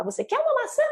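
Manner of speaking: put-on very high-pitched childlike question; child asking. Child comes to parent wanting an apple, childlike question. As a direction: ask it in a small child's high voice. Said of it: "a criança chega pra gente e fala".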